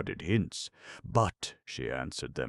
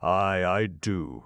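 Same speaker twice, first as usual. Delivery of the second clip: slow, louder, defiant; slow defiant aye; 4 semitones higher, 6 dB louder, slower than usual. A narrator's reading. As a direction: hold his gaze and mean it. Nancy defiant.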